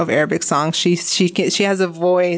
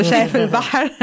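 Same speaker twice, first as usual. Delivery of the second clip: شايف البحر in a full Arabic accent, fast and higher; 5 semitones higher, much faster than usual. Shown as a demonstration. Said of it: "here's my Arabic accent".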